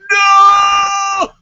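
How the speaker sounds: high, loud wailing no; mock anguished scream. Author seeing John Cena, wailing in mock despair. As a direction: howl it in fake despair. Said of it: "And I'm like".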